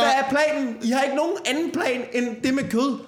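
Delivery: high, laughing, exasperated ranting. Real, not acted. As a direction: rant at them half laughing, in disbelief. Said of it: "færdig med mit rant".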